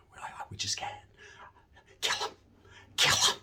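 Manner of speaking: unvoiced urgent whisper; covert whisper. Homeboys secretly plotting at the chow table, whispering. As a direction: whisper it so only the homeboys hear.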